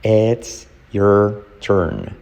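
slow, separate words: IT'S, YOUR, TURN; slow model take. Shown as a demonstration. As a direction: leave gaps between "It's", "your" and "turn". Teacher models words one by one.

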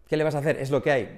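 usual speaking voice

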